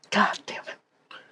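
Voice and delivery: real whispered curse, slow and soft; frustrated whisper. Real, not acted. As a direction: breathe the curse out under your breath. Losing the forbidden word challenge.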